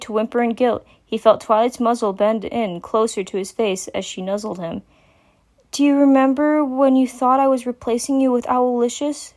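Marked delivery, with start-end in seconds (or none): 5.7-9.3 higher, gentle voice; tender caring mare; calm. A narrator's reading. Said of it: "spoke calmly as she could".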